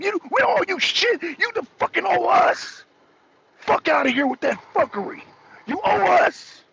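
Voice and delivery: high-pitched, furious, indignant; angry rant. Real, not acted. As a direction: tell them off with real outrage.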